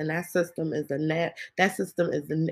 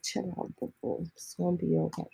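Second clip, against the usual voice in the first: mock soothing; 6 dB quieter than usual. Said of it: "I know y'all like".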